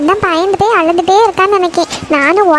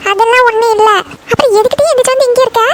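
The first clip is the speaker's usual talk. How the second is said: higher-pitched, cross questioning; scolding mother; 4 semitones higher than usual. Acted. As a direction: tell the kids off for being up late. Mother scolds children up at night.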